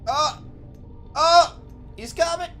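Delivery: high, keyed-up urging; tense excitement. Real, not acted. Streamer watching the hippo finally come, high eager urging. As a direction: build anticipation, eager.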